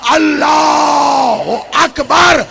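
very slow, drawn-out, loud high Allahu Akbar; takbir battle cry. Acted. Abbas on the battlefield shouts the takbir, long loud roar. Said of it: "अब जो अब्बास ने कहा ना".

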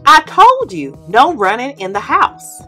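usual speaking voice

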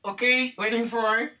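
usual speaking voice

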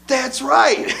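high, impressed parent voice; amazed praise, retold. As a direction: marvel at a child, half laughing.

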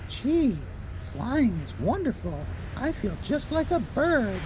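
high, slow delighted Big Bird; high wondering bird voice. A narrator's reading. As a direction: marvel at it, high and childlike. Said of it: "he said to his teddy bear".

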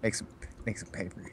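quick hushed whisper. Real, not acted. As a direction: whisper it under your breath. Player whispers a crafting tip.

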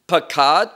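Pakad said loud with a rising end, pronounced pah-KAHD; Hebrew word. Shown as a demonstration. Preacher teaching the congregation the Hebrew word Pakad, pah-KAHD, loud and rising. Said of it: "another important word here is".

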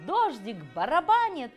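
ordinary delivery

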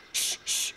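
two short unvoiced shushes; shushing. Brother silencing his sister near offended Toby, shushing. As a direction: shush softly, twice.

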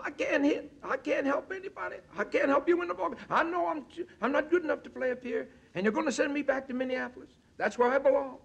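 put-on a young ballplayer near tears saying he isn't good enough; high tenor voice. Manager imitating sobbing Willie Mays in the clubhouse, high tenor voice. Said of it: "he used to talk in a high tenor voice".

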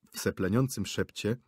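usual speaking voice

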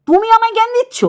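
outraged high-pitched comeback; indignant high retort. Real, not acted. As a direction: voice jumps high on জ্ঞান in disbelief. Indignant rebuke of a rival creator.

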